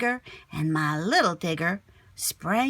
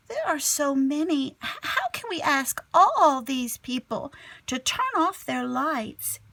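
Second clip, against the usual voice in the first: put-on worried kid; 8 semitones higher, 3 dB louder than usual.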